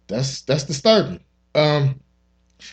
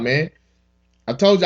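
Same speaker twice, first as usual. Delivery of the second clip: ordinary delivery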